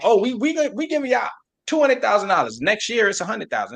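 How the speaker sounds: hammed-up promise of money that shrinks; lightly voiced smug official; mocking officials.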